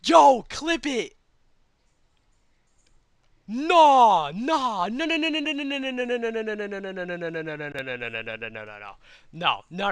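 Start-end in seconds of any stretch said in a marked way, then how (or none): 3.5-9.9 panicked no. Real, not acted. Streamer watching himself die in the game, frantic nos fading out. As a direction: start frantic, let it die out.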